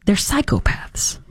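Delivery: slobs breathed out as a disgusted whisper; whispered insult. Real, not acted. Host whispering contempt at protesters, hushed disgust. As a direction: hiss it under your breath in contempt.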